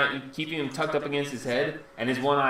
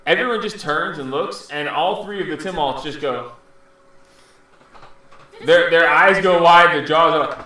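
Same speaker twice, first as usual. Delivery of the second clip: ordinary talk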